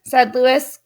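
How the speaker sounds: plain reading voice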